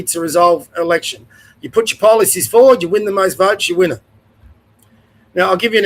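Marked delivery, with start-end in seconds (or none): none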